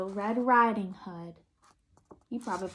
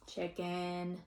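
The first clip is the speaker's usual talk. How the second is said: usual speaking voice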